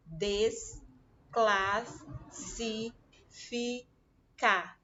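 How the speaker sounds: "Desclassificar" drawn out very slowly as des-clas-si-fi-car; syllables shown. Shown as a demonstration. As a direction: tap out each of the five beats of "Desclassificar". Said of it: "Tem cinco sílabas". ("Desclassificar" bem devagar, sílaba por sílaba)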